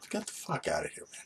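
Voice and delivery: mock yell with hardly any voice; half-whispered telling-off of gawkers; muted retort.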